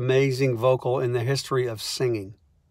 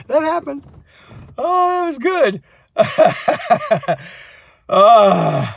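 laughing; 10 semitones higher, 6 dB louder, slower than usual. Real, not acted. Laughing at own story.

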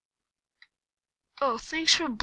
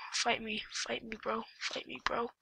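whispered taunt; 11 dB quieter than usual. Acted. Taunting opponent softly.

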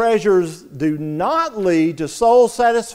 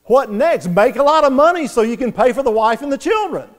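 higher and louder with every "what next"; building urgent back-and-forth; 5 semitones higher, 3 dB louder, faster than usual. Retold or imitated. Inner voice questioning the young man, ever more insistent. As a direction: press harder each round, pitch climbing. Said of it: "the voice says".

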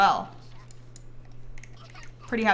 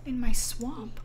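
whisper; 6 dB quieter, slower than usual. Real, not acted. Shocked at game event.

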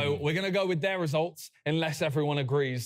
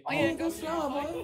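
much higher, breathy mock seduction; breathy sultry voice; 11 semitones higher than usual. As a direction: purr it like a cheesy flirt. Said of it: "talking about J O I and with the".

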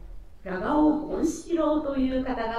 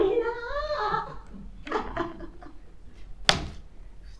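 high breathy laugh; 13 semitones higher than usual. Acted. Delighted laugh.

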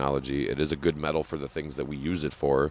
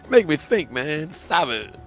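laughing protest; 13 semitones higher, 7 dB louder, slower than usual. Real, not acted.

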